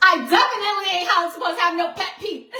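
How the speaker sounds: giggling fast through the words; cracking up mid-sentence; laughing. Real, not acted. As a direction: laugh at your own slip of the tongue. Laughing at own slip.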